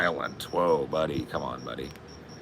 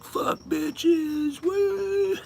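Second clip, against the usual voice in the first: much higher wild whooping frat yell; drunk party whoop; much slower than usual. Retold or imitated. Movie guy drunk on beers, high wild whoop. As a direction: let loose a drunk falsetto whoop. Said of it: "He's like".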